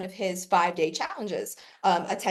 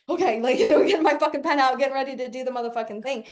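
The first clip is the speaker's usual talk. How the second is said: high, loud, laughing eagerness; eager student; 4 semitones higher, 4 dB louder, faster than usual. Retold or imitated. Herself getting the pen out for Myron, laughing eagerness. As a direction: rush to grab the pen, thrilled. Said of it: "And I was like".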